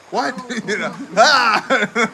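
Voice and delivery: slow, loud laughing cry of disbelief; laughing shock. Real, not acted. Hearing his mom is upstairs, laughing in shock. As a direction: laugh out a stunned ah.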